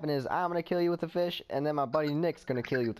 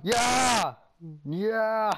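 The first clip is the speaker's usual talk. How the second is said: cheering; 4 semitones higher, 4 dB louder than usual. Real, not acted. Triumphant cheer.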